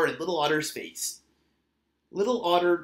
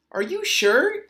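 doubtful; 11 semitones higher, 6 dB louder than usual. A narrator's reading. Mother questions her son.

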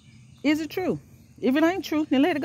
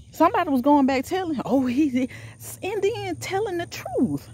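high, fervent preaching voice; 9 semitones higher than usual. Real, not acted. Preaching to her viewers about Jesus and haters, fervent sermon.

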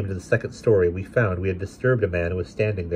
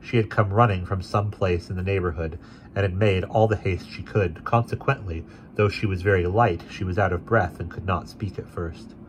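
plain reading voice

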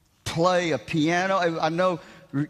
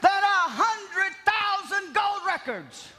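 raised, ringing sermon voice, higher than his chat; preaching; 10 semitones higher than usual. Acted. Pastor preaching to his congregation, in a raised ringing preaching voice. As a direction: preach it out to the church with full conviction.

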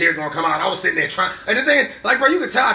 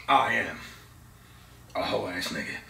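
lower, quieter mock villain mumble; mock defeated villain; 9 semitones lower, 8 dB quieter than usual. Thanos failing his snap, low quiet mock defeat. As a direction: deflate it like a beaten tyrant. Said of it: "he was like".

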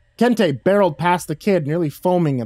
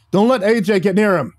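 urgent, higher-pitched warning shout; alarmed raised-voice command; 6 semitones higher, 4 dB louder than usual. A narrator's reading. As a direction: shout the order across a fight. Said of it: "Sandhu shouted".

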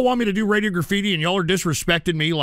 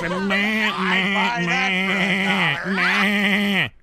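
mocking meh; much slower than usual. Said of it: "pissing and moaning about".

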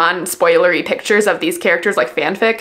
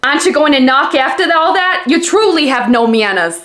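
exaggerated New York door-handle character; New York accent. Reader imitating an audiobook's talking door handle, exaggerated New York accent. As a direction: ham up a thick New York accent, badly. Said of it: "this New York accent".

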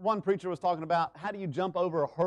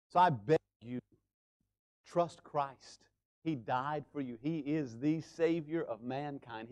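soft appeal; 3 semitones lower, 5 dB quieter than usual. Real, not acted. Quiet altar-call appeal.

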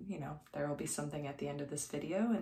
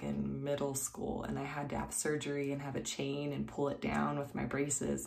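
normal talking voice

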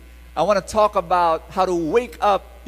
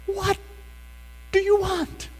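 pleading question; 13 semitones higher than usual. Retold or imitated. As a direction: ask the baby gently, high. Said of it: "And I said".

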